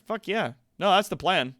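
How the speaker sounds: ordinary talk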